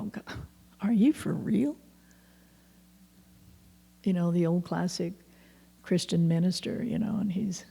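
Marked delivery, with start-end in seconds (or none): 0.0-1.8 higher-pitched, mildly stunned disbelief; incredulous reaction. Retold or imitated. Recalling amazing stuff seen along the way, incredulous. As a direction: react as if you can hardly believe it.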